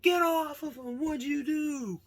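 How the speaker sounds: high, loud, drawn-out cry; imitated alarmed yell. Friends pulling a fighter off someone, imitated yelling. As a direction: call it out high, stretching the end.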